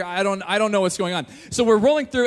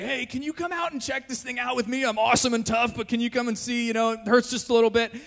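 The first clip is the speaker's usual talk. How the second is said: mock-casual, higher voice holding back tears; acting tough; 3 semitones higher than usual. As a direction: play it tough while trying not to cry. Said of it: "always wants to seem really tough".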